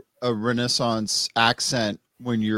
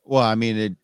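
normal talking voice

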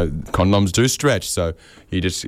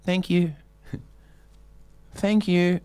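soft, high, sweet thank you; polite thank you voice; 10 semitones higher, 4 dB quieter, slower than usual. Shown as a demonstration. Cyclist thanking walkers for letting him pass, sweet. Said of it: "a voice I don't use in any other context".

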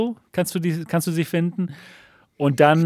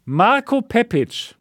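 booming prize announcer; much slower than usual. Acted. Winner reveal countdown.

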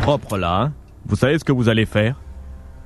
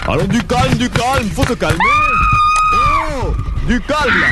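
higher, shouting at her to calm down; shouted hushing; 12 semitones higher than usual. Acted. Man shouting at the girl to calm down. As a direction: bark calm at her over the struggle.

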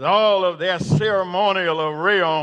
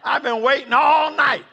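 high, loud eager shout; Peter shouting; 7 semitones higher, 4 dB louder than usual. Acted. Peter shouts to the court, high and loud. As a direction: burst out like a man who can't wait. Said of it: "I hear Peter say".

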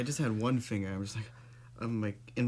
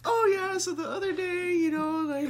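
high mocking chatter; 4 dB louder than usual. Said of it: "just like".

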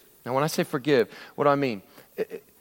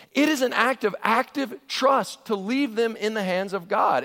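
ordinary talk, nothing marked

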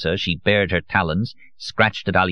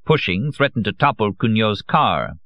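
ordinary delivery

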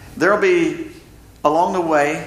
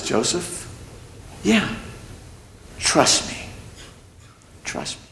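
gentle God; 7 dB quieter, slower than usual. Acted. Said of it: "and God says".

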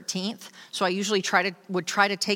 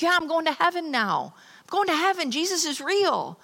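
excited brother; 10 semitones higher than usual. Retold or imitated. Brother Larry telling his sister he found faith, excited. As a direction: gush it out like big news. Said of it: "And he's like".

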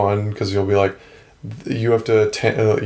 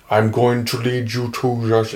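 put-on thick Finnish accent. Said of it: "very, very thick Finnish accent".

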